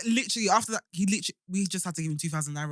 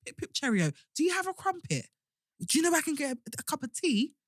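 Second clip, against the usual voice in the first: hammed-up, high, lilting British accent; milked British accent; 8 semitones higher than usual. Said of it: "I milk this accent".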